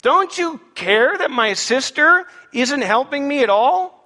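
slow, high-pitched indignant question; aggrieved complaint. Acted. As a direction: complain with wounded disbelief, stretching the words. Voicing aggrieved Martha's complaint.

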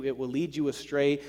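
ordinary delivery

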